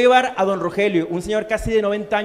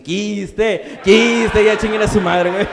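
mock loud yell; 5 dB louder, much slower than usual. Friends tease, he snaps back.

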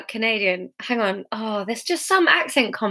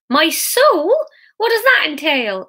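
high, loud alarmed shriek; 8 semitones higher, 4 dB louder than usual. A narrator's reading. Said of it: "Martin Young shrieked".

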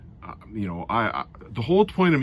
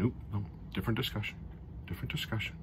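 hushed aside; 11 dB quieter, much faster than usual. Real, not acted. Catching himself on contract talk.

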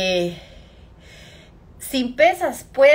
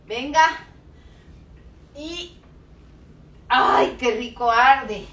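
straining; 3 semitones higher, 4 dB louder, slower than usual. Real, not acted. Straining through the last rep.

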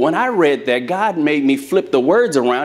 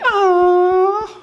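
high, drawn-out aww; swooning aww. Retold or imitated. Office ladies swooning at the coach's newlywed goal, high aww. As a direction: melt like the ladies did.

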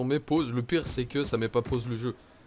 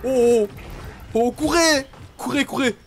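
high loud frantic shouts to run; urging himself to flee; real panicked cries, rising to shrieks; panicked yelling; 9 semitones higher, 4 dB louder, slower than usual. Real, not acted. Fleeing monsters in game.

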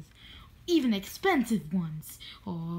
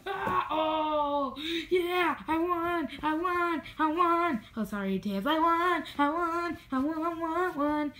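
triumphant cheering; 8 semitones higher than usual. Acted. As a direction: crow about the win. Victory cheering.

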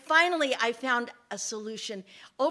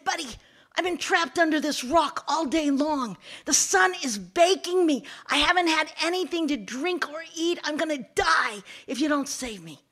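loud, mildly raised plea; pleading snake; 5 semitones higher, 7 dB louder than usual. Acted. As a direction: plead for rescue, a touch desperate. Trapped snake pleads for rescue.

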